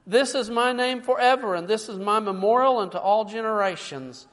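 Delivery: loud preacher proclaiming scripture; booming pulpit reading; preaching. Acted. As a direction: read it out with full force. Preaching scripture at full force.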